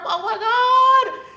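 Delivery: high, loud, slow sung-out cry; excited exclamation. Real, not acted. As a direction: sing it out high with glee. Excited about the initiation program.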